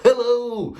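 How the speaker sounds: high, overexcited fake-cheery hello; Barney the dinosaur. Cold caller mocked as a fake-cheery dinosaur, squealing hello. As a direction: gush it like a kids' TV dinosaur. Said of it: "They sound like Barney the dinosaur".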